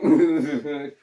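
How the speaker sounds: loud imitated giggle of a smitten teen; put-on bashful tittering. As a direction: giggle like a crushing fourteen-year-old. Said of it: "just fourteen-year-old going".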